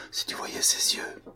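hushed, almost inaudible whisper. A narrator's reading. Don Luis whispering to Mazerou on the roadside, hushed. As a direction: breathe it out so low he barely hears. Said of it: "Il parlait si bas".

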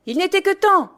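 high, loud gruff coachman; coachman grumbling. A narrator's reading. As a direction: grumble with relief after the near miss. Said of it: "grommela le cocher". (cocher qui grommelle, voix haute et forte)

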